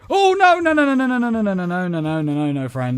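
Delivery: high loud panic fading down; panicked pleading. Real, not acted. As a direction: plead in rising terror, then calm. Monster closes in.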